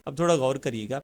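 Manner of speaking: ordinary delivery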